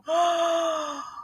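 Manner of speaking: a long, high gasp of horror; high gasp of dismay. Real, not acted. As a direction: gasp at the terrible news. Hears friend lost house.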